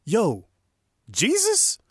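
loud, high-pitched incredulous "Yo"; casual yell shooting up into a high question; modern-day disciple. Acted. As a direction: call out in disbelief like someone today. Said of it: "that's how they would say it today".

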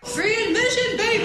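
very high-pitched squealed heckle; hammed-up falsetto scream; mock scream. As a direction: shriek it from the crowd at the top of your range. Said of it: "and someone screams".

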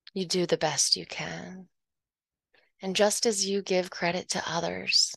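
ordinary delivery

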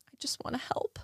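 soft breathy whisper of the motto; whispered quote. Retold or imitated. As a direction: whisper it like a cherished saying. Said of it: "I'm gonna quote my biological father".

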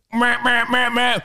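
mocking meh meh meh; dismissive sing-song meh; mocking blah blah. Mocking the others' reaction.

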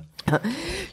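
squeaky high laugh. Real, not acted. Laughs after quote.

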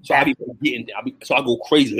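ordinary talk, nothing marked

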